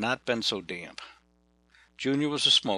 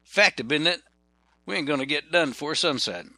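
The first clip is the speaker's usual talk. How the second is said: Fact, isn't it? slurred into one Southern word, higher; 6 semitones higher, 5 dB louder than usual. Retold or imitated. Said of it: "Ken would say".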